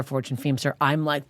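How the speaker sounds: ordinary delivery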